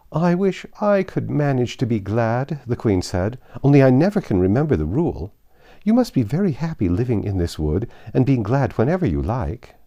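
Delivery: high, fluttery queen voice; a dithering queen chattering on. Acted. Flustered Queen talking to Alice.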